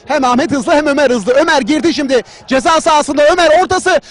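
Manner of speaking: screaming football commentator. Acted. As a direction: rise into a full roar as the ball hits the net. Goal call in football commentary.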